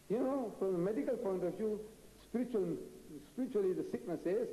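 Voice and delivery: ordinary talk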